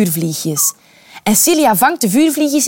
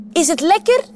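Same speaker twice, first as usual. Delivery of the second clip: higher, sugary witch asking kindly; sweet little voice; 7 semitones higher than usual. Acted. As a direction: sweeten the voice to hide the danger. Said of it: "met een zoet stemmetje". (hoger, zoet stemmetje van de heks)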